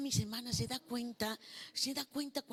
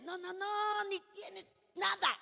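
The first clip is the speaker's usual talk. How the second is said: preaching shout; 10 semitones higher than usual. Real, not acted. Preaching certainty.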